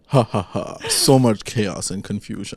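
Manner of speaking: low, quiet schemer's chuckle; mock villain gloating. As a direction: gloat softly to yourself like a plotter. Imitating a scheming man's gloating.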